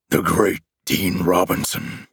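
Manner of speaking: slow, mocking monster growl; monster taunt. A narrator's reading. Monster mocking his begging opponent, slow taunt. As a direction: taunt him slowly in a monstrous voice. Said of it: "it taunted".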